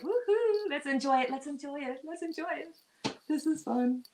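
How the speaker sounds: high, giddy whoop and sing-song cheer; gleeful universe. Pretend. Voicing the universe cheering the chaos, giddy and high.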